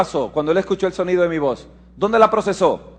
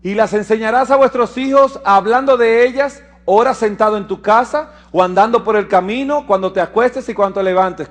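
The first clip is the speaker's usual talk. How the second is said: loud, high scripture declamation; lectura de las Escrituras; 4 semitones higher, 4 dB louder than usual. Acted. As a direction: proclaim the verse to the congregation. (lectura bíblica proclamada con fuerza)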